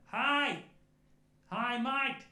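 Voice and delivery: high, loud Australian call-out; cool wee Australian voice. Retold or imitated. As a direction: call cheerily from another room, Australian accent. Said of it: "shouting out to us with his cool wee voice".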